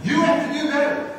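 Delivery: harsh correcting shout; scolding. Acted. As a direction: snap at the children. Harsh voice contrasted with Jesus's.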